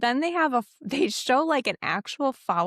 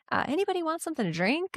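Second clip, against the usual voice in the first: casual hostess; 7 semitones higher, much faster than usual. Retold or imitated. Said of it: "she goes".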